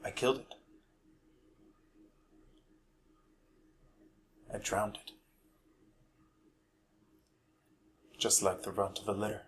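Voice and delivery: hushed confession with long, heavy pauses; very soft, sinking almost to a whisper; Dodge. Acted. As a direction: confess a dark family secret quietly. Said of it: "I'll be performing the role of Dodge".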